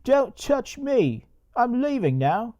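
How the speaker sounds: high, drawn-out mock pissy whine; hammed-up huffy Englishman in a squeaky pitch; Creepy English, pissy. Creepy English gets shoved away by security, pissy mock voice. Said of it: "gets all pissy about it".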